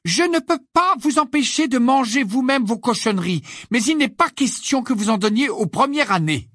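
high, loud scolding lecture; stern scolding. Acted. Hermione forbids the twins, sternly scolding. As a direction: lay down the law firmly.

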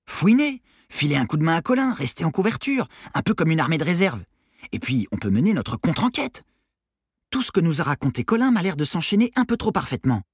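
high-pitched, eager pitch; scheming teenage Armand. Acted. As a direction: sell the plan like an excited teenager. Armand pitches his plan. (ado enthousiaste qui expose son plan)

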